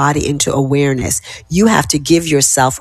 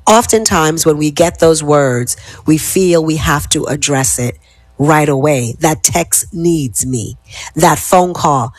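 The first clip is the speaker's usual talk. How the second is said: usual speaking voice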